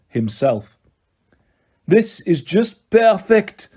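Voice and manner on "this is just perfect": put-on slow, higher French-accented gloat; drawn-out, triumphant French accent. A narrator's reading. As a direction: savour it like a French villain. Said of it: "the Manticore gloated".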